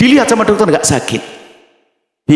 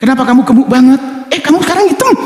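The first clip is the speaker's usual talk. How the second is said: tactless friend; 13 semitones higher, faster than usual. Retold or imitated. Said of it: "kalau nggak nyelekit bukan dia".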